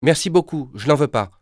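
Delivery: put-on boy politely turning down the offer. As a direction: decline sweetly and courteously. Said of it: "d'une voix très polie".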